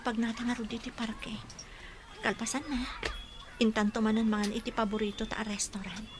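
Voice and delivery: breathy unvoiced whisper, close and private. Woman whispers a plan to her love. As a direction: whisper it like a secret.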